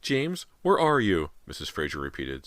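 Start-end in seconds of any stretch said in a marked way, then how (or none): 0.0-1.3 high, loud, slow sobbing plea; mother sobbing for her son. A narrator's reading. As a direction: sob the question out.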